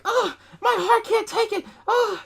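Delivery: high, hammed-up old lady panic; fake panic. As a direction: play a fake fainting spell. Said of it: "she starts acting and panicking".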